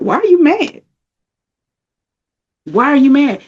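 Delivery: high-pitched incredulous question; high, exasperated self-scolding; scolding inner spirit. Retold or imitated. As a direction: jump up high, asking in mock disbelief. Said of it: "My spirit was like".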